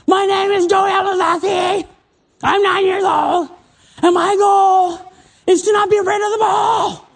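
put-on high, loud shouted declaration like a kid at camp; shouting like a boy. Eight-year-old at baseball camp shouting his goal at the lake, high shouted. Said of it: "if you don't say it loud enough".

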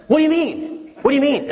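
put-on very high, breathless kid asking twice; eager little boy. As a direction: burst in wide-eyed like a kid. Son runs in excited.

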